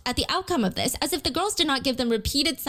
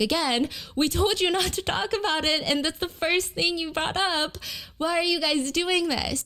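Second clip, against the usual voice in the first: playful scolding, pitched higher; light, high-voiced telling-off; lighthearted complaint; 6 semitones higher than usual. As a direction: imitate girls gently protesting. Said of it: "very lighthearted".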